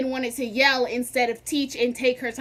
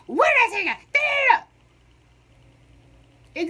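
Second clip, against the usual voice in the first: high, louder mock yell at the class; barking teacher; 11 semitones higher, 5 dB louder than usual. Teacher yelling at her math class, a high mock bark. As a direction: bark it at the students. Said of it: "she's barking".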